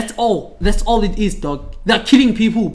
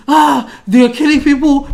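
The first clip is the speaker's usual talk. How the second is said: raised, hammed-up dismay; mock alarm; 6 semitones higher, 4 dB louder than usual. United Nations onlookers fretting over killings, mock alarm. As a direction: cry out in pretend helpless horror.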